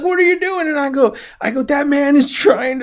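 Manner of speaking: high, loud breathless panic; panicked retelling. Retold or imitated. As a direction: blurt it out in a frightened rush. Retelling panicked escape to old man.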